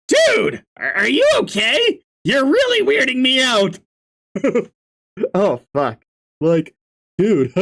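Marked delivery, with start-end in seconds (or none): 0.1-3.8 alarmed. Acted. Alarmed reaction to Gamzee.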